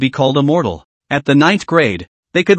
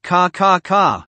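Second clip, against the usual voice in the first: crackling claw sound; 3 semitones higher, much slower than usual. A narrator's reading. Blood claws crackle.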